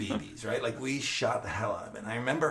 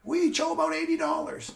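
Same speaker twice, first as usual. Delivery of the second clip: grandfather explaining; 3 dB louder than usual. Retold or imitated. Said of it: "he says".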